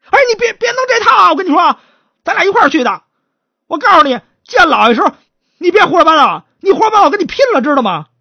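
loud, high, fast furious warning; angry shouted threat. Acted. Chatterbox shouts a threat at the stutterer before seeing the master. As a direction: threaten him at full volume.

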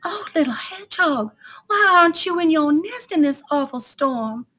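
high-pitched, concerned mother deer. Acted. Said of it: "she asked".

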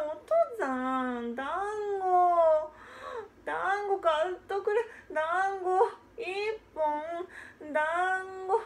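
put-on high, tearful little boy pleading for a dumpling; whiny child begging. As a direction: wheedle like a small kid on the verge of tears.

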